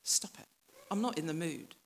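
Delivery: hushed, annoyed whisper; grumpy whispered brush-off; irritated shushing whisper. Retold or imitated. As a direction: hush them up, not in the mood. Grumpy hiding men shushing.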